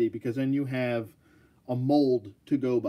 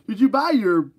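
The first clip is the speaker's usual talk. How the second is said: salesman voice; 9 semitones higher, 11 dB louder than usual. Shown as a demonstration. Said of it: "I gotta say it in a specific voice".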